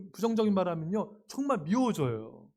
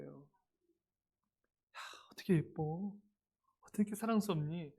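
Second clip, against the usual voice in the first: soft whispered adoration; a parent hugging their child close, whispering tenderly; 9 dB quieter, slower than usual. Pretend. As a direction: whisper it into the child's ear as you hug them.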